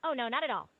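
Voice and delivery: normal talking voice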